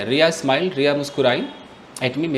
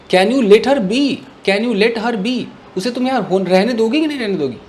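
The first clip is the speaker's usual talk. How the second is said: higher, louder, exasperated demand; angry plea; 6 semitones higher, 5 dB louder than usual. Retold or imitated. Son demanding mother leave the guest alone, heated. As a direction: push back at mother, heated. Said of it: "गुस्सा आ गया".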